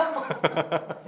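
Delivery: a real burst of loud laughter; laughing. Real, not acted. Actress cracking up at the host's catchphrase, real laughter. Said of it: "Eu tô rindo aqui só".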